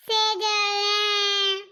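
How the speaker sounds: loud, very slow drawn-out call of the name; long call. Acted. Aunt summons Sejal from the kitchen, a long loud drawn-out call. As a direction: stretch the name out and call her across the house.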